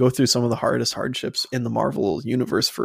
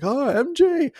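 much higher, a hammed-up cry for MJ; mock worried hero; 4 dB louder, slower than usual. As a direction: imitate the hero calling out, pitched way up. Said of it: "Tom Holland Spider-Man's like".

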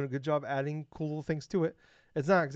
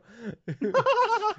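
high laugh; 7 dB louder than usual. Real, not acted. Laughing at own story.

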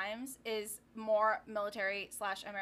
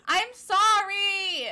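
loud, high, drawn-out sorry; wailed apology; 10 semitones higher, 15 dB louder, much slower than usual. Acted. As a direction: plead in a hammed-up wail. Exaggerated apology to stifling co-host.

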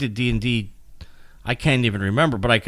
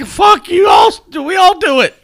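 1 retold shout; 11 dB louder than usual. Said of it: "and I'm like".

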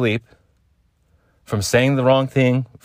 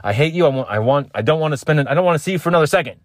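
higher, fast angry rant; mock furious rant; 6 semitones higher, faster than usual. Grumpy just-woke-up self ranting at a partner, fast and high. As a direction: spit it out fast like a grumpy roommate. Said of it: "It's either those two".

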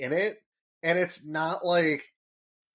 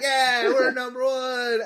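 put-on louder, higher cheering kid voice; Bart; 7 semitones higher, 5 dB louder, slower than usual. Bart crashes the announcement, cheering. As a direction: brat shouting his triumph. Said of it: "And Bart's like".

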